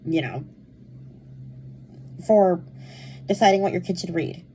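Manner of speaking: ordinary delivery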